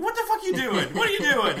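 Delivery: high, loud laughing disbelief; laughing outcry. Retold or imitated. Retelling a torture scene, laughing and shouting in disbelief. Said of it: "You're just like, it's like".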